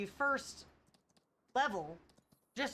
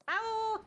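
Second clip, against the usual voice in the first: high, loud imitated cat meow; 12 semitones higher, 4 dB louder than usual. Shown as a demonstration. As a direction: call out to the cat like a cat. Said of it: "Every time I meow".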